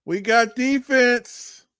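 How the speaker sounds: high, loud joking cheer; playful high-pitched hype with a whoop; mock cheering. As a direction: cheer for the defense in jest, drawing it out. Mock cheering, then "just kidding.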